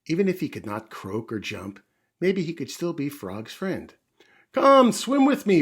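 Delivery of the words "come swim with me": much higher, much louder joyful call; happy call. Acted. Frog inviting the mouse to swim, loud happy call. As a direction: call out happily before leaping in. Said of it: "Frog called happily".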